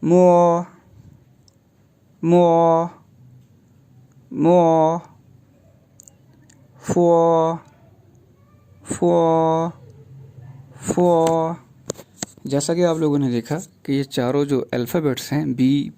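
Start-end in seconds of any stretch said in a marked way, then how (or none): 0.0-5.0 मो said as Mandarin mo, rounded o; मो, clear m and a held o; मो like the Mandarin syllable mo; pinyin mo. Shown as a demonstration. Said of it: "तो यूँ pronounce किया जाएगा जी". 6.8-11.6 फो said as Mandarin fo, lip-teeth f; फो like the Mandarin syllable fo; pinyin fo. Shown as a demonstration. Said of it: "तो यूँ pronounce किया जाएगा जी".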